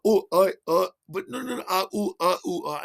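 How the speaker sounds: high, broken protests; imitated helpless babbling; flustered stammering. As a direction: sputter without finishing a thought. Friend sputters back, acted.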